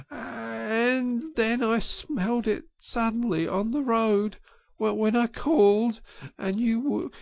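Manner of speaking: high, broken, sobbing voice with stammers; sobbing brokenly. A narrator's reading. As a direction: sob out the words, choking on them. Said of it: "he sobbed forth at last brokenly".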